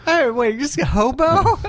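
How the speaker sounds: high, loud, amused disbelief; surprised. Real, not acted. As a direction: jump up in pitch, caught off guard by the joke. Reacting to partner's hobo nickname.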